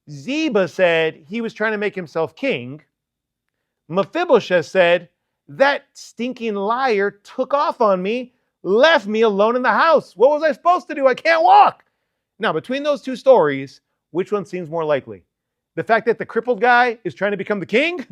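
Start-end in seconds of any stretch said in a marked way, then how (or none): 5.5-11.9 a lame man pleading, voice rising to high and loud; slow start building to a high, loud cry of helplessness; rising helpless plea. Retold or imitated. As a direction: pitch climbing higher with each line, slow at first. Mephibosheth answers, rising plea.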